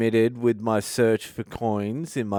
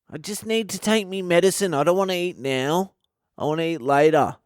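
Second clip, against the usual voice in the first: whiny beggar; 7 semitones higher than usual. Retold or imitated. Homeless man begging the comedian, whiny high voice. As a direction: whine like a caught-out hustler.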